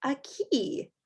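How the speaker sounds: ordinary delivery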